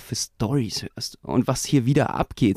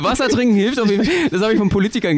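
laughing; 8 semitones higher, faster than usual. Real, not acted. Laughing at own joke.

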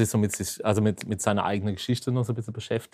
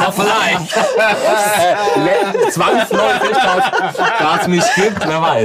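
laughing; 11 semitones higher, 9 dB louder, slower than usual. Real, not acted. Laughing at misheard Großmutter remark.

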